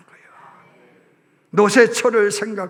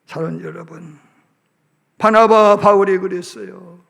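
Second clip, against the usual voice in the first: ordinary delivery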